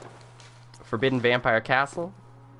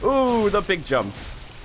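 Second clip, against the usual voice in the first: drawn-out ooh; 9 semitones higher, much slower than usual. Real, not acted. Enemy does big jumps.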